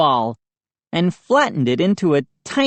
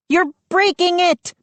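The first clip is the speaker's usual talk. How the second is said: high frantic shriek; Mindy hears the table crack, shriek; 12 semitones higher than usual. A narrator's reading. High panicked cry. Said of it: "Mindy shrieked".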